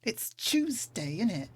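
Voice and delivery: London "Tuesday" then "innit"; British slang. Shown as a demonstration. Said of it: "I do say "innit" a lot".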